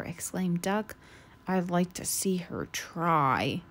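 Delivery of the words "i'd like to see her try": low, slow grumbling groan. Acted. Goose groaning to Duck, low and grumpy. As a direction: grumble it under your breath. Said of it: "groaned Goose".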